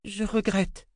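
put-on a child saying sorry to the queen; young girl Rachel. A narrator's reading. Girl apologizing to the queen, meek.

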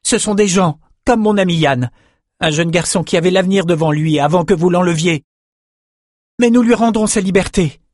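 high, loud and indignant; loud angry outburst. A narrator's reading. Bannon defies the slavers at dinner, loud and indignant. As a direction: raise the voice so the whole table hears. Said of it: "assez fort pour que tout le monde entende".

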